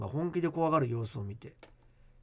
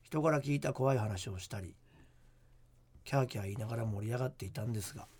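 hushed creepy ghost story; 3 semitones lower than usual. Acted. Reads listener ghost letter.

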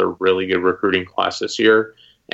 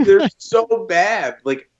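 drawn-out disbelieving whine, pitched up; exasperated; 9 semitones higher, much slower than usual. Real, not acted. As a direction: stretch it out in exasperation. Exasperated rant about LSU.

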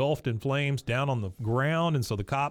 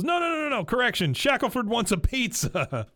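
Dale shouting; 9 semitones higher than usual. Retold or imitated. Dale correcting frantically.

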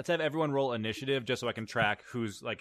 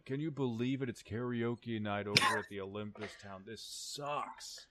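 complaining minor god; 5 dB quieter than usual. Acted. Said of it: "what sounds like a minor god complaining about the curfew".

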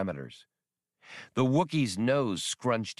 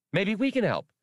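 high, eager little droid voice; childish droid voice; 11 semitones higher, 3 dB louder than usual. Acted. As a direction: chirp it like a know-it-all kid robot. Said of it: "Max piped brightly".

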